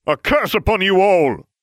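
high, loud theatrical cry; hammy, ringing proclamation; melodramatic curse. Acted. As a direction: hurl a dying curse at the whole room. Severus curses everyone while dying.

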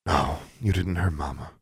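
soft, gentle sigh of comfort; sighed reassurance. A narrator's reading. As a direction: reassure the child while rocking her. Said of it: "Claudia sighed".

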